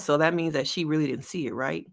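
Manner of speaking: ordinary talk, nothing marked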